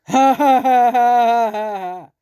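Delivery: loud cackle falling away at the end; big acted evil laugh; croaking villain cackle. A narrator's reading. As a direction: cackle like a croaky storybook monster. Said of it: "skřehotavý hlas strašného pytla".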